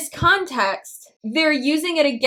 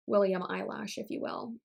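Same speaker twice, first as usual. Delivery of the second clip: ordinary delivery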